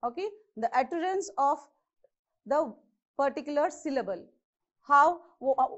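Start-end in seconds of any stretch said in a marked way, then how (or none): none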